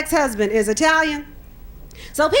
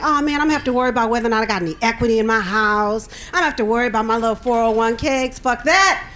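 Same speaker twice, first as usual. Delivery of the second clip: rattled off fast, a bit lower; fast, lower mock fretting; rushed inner worry; 5 semitones lower, faster than usual. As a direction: hurry through the old worries. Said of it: "I used to think".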